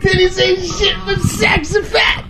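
high, slow, laughing chant; frat bro. Pretend. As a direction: belt it out while cracking up. Echoing the quoted lyric, laughing.

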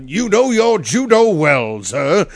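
slow, grand mock compliment; mimicking unruffled arrestee. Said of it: "he doesn't get mad".

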